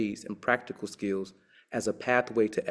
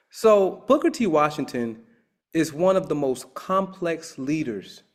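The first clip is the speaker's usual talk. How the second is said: excited; 5 semitones higher, 5 dB louder than usual. Real, not acted. Lecturer building enthusiasm.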